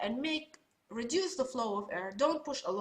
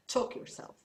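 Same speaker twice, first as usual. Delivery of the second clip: hoarse whisper. Real, not acted. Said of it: "I need water".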